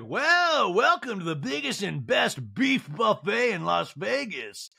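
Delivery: high, drawn-out announcer holler; exaggerated emcee impression; strip-show MC. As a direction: hype up the crowd, stretching the last word. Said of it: "the M C's like".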